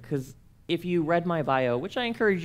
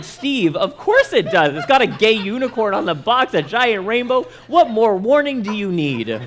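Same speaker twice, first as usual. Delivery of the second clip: higher and much louder, laughing through a mock-obvious answer; laughing mock certainty; 8 semitones higher, 10 dB louder than usual. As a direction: laugh as you insist it's obvious.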